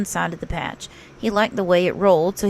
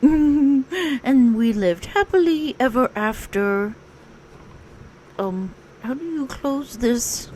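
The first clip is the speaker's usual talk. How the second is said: slow, higher bear voice signing off; a sleepy, contented bear chatting to listeners; Pooh; 4 semitones higher, slower than usual. Acted. Pooh saying goodbye to the listeners after the story.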